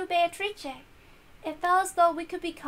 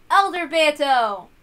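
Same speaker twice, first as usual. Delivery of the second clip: loud, slow call across the room; a long, loud hail to Elder Beato; 11 dB louder, slower than usual. Acted. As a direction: call out to her, drawing it out. Calling out to her elder self.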